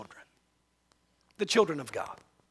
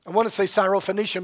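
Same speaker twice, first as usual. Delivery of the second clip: ordinary talk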